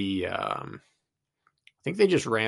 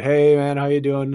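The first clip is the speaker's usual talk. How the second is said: cheery loud greeting; 4 semitones higher, 8 dB louder than usual. Retold or imitated. Runner calling a friendly hello to the homeowner, loud. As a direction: call out hello, bright and louder.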